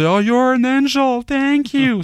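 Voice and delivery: very high, slow gushing thanks; grateful wife. Retold or imitated. American's wife thanking her helper, high mock gushing. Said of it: "Ça, c'est sa femme".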